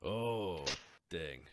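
normal talking voice

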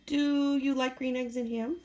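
usual speaking voice

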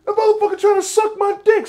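high, loud, incredulous yell; outraged retort. Retold or imitated. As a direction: imitate someone loudly indignant at a proposition. [name] explaining his outrage.

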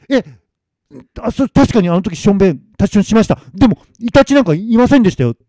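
high, stammering excuse; flustered defence. Acted. Masamitsu defending himself to the spirit, flustered.

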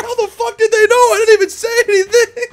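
high-pitched incredulous whine; squeaky mock disbelief. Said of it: "He's like".

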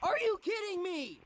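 loud, high-pitched disbelief; outraged yell. Real, not acted. Gamer disputing the game, outraged yell.

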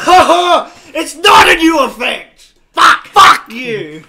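high, loud laughing yells; excited shout. Real, not acted. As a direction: scream in overjoyed disbelief. Unusual item unboxed.

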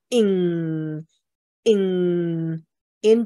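slow "in，in" with the back-nasal ng ending; ing ending demo. Shown as a demonstration. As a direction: stretch "in" into a back nasal ng, slowly. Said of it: "注意啊，它有一个in".

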